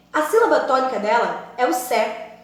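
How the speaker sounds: ordinary talk, nothing marked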